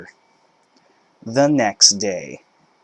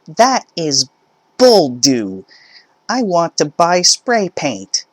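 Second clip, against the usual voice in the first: louder, higher, furious; angry mother; 9 semitones higher, 7 dB louder than usual. Acted. Mother raging at the cashier, high loud furious voice. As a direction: spit the words out. Entitled mother arguing at checkout.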